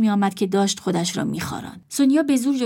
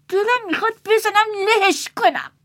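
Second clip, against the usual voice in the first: put-on high, louder, fed-up young woman; Leila's anger; 12 semitones higher, 4 dB louder than usual. A narrator's reading. Leila fuming at the brother, high and fed up. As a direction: vent through the shirt.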